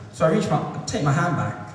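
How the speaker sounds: ordinary talk, nothing marked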